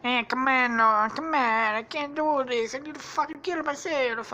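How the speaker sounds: high, whiny character voice; mock despair. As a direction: protest in a high cartoon whine. Acting character's despair in the story.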